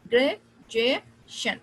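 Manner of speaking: "ग्रेजुएशन" split slowly into gre, jue, shan; slow, separated syllables in "ग्रेजुएशन". Shown as a demonstration. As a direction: pause between the three parts of "ग्रेजुएशन". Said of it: "ये जुए जो है".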